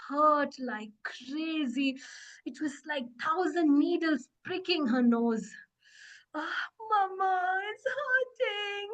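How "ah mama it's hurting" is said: put-on high, slow, drawn-out child's wail; crying. Hurt Boo Boo calling Mama, acted crying. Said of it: "She coughed and she cried".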